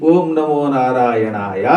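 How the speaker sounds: low, slow, drawn-out mantra; mantra recitation. Shown as a demonstration. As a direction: intone it low, each syllable lengthened.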